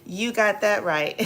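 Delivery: ordinary talk, nothing marked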